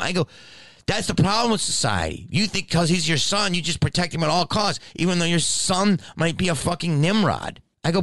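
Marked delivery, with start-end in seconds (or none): 0.9-7.6 high, exasperated scolding; indignant rant. Retold or imitated. Scolding a mother who protects her son, indignant. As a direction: retell a lecture at heated high pitch.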